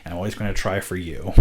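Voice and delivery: ordinary talk, nothing marked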